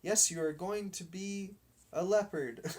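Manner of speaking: high teasing voice through laughter; laughing captain. Acted. Boy captain teases the dog, laughing. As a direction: laugh him down.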